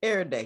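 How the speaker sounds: "Every day" run together as "err-day"; slangy pronunciation. Shown as a demonstration. Guest showing the host how, "Every day" as "err-day". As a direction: drop the middle of "Every", making "err-day". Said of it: "Gotta say it like that".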